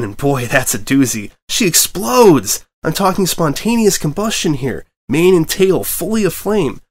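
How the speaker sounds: high, loud, gleeful build-up; excited storytelling. Acted. Dragon gleefully describing the freakout scale, excited. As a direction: relish every gory detail.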